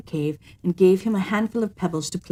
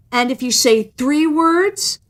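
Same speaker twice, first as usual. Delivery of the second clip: higher and slower, coaxing; sly cat; 6 semitones higher, 5 dB louder, slower than usual. A narrator's reading. Cat pushing the woman's bargain further. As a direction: draw the question out craftily.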